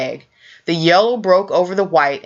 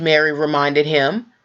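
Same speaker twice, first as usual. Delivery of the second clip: usual speaking voice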